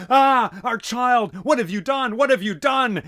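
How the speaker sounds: high loud anguished shriek. Acted. Parvati shrieking at Shiva over her dead child. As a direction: scream it in horror and grief. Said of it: "She shrieked out".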